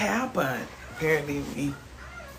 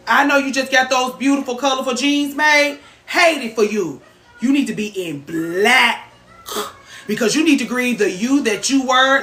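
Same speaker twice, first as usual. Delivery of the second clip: hammed-up lecture; 5 semitones higher, 7 dB louder than usual. Mock sermon telling viewer to grieve.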